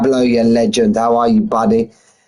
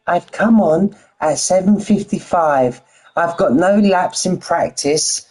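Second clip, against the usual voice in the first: higher-pitched mimicking of a chat friend; impression of Armain; 5 semitones higher than usual. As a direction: parrot his line in a higher put-on voice. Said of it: "Let's say it exactly how our main would say it".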